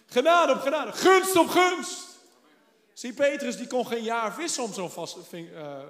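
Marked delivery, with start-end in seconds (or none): 0.1-2.0 louder and higher, slowing on Gunst op gunst; rising proclamation. Real, not acted. Preacher proclaiming grace to the church, loud rising call. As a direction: build it up to a ringing cry.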